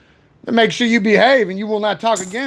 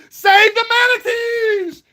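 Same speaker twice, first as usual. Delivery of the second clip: slow, high drawn-out shout; 13 semitones higher, much slower than usual. Acted. Shouted catchphrase in stream banter.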